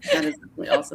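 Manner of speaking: laughing through the words; high, giggling delight. Real, not acted. Host breaks into laughter.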